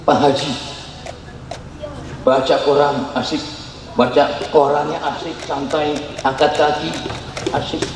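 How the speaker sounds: lightly voiced relaxed haji; lounging, feet up, reading; a hint of a lazy reciter.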